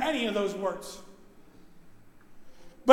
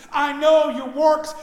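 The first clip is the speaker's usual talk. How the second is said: louder, slow, rising pulpit cry; loud slow proclamation; 3 semitones higher, 9 dB louder, slower than usual. Acted. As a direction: proclaim each word to the churches. Quoted verse, loud and slow.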